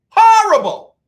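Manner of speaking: Horrible flung out high and loud; emphatic shout. Real, not acted. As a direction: hit the word Horrible hard with a shout. Emphatic verdict on band.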